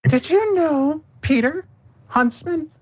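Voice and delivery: put-on high, sweet child's voice; a little girl sharing a secret. Little girl addressing Peter.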